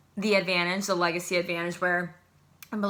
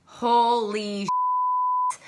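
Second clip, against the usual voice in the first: stunned; 4 semitones higher, 3 dB louder, much slower than usual. Real, not acted. Shocked by Haley's performance.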